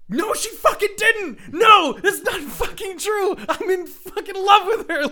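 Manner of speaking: high, loud mock screaming; hammed-up high yell; imitated screaming. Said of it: "screaming and kicking and, like, yelling".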